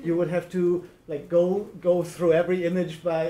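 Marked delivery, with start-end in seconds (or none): none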